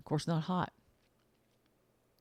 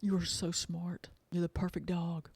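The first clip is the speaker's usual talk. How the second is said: praising the dog; 3 semitones higher than usual. Pretend. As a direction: coo lovingly down at the dog. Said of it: "You might look down".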